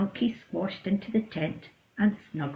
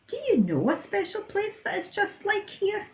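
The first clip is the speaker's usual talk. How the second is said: puzzled donkey; 12 semitones higher than usual. Acted. Donkey asks Goat, puzzled.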